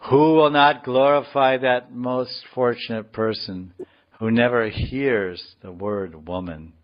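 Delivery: measured, emphatic declamation; deliberate reading with weighty pauses; scripture reading. Acted. Reads translation aloud.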